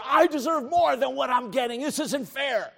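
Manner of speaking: high, loud protest; mock aggrieved complaint. Preacher voices our murmuring against God, mock aggrieved. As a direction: gripe like someone who feels cheated. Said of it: "we're saying".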